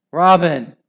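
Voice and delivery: wife crying out to her returned husband; joyful cry. Acted. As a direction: cry out in relief. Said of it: "cried Hyacinth".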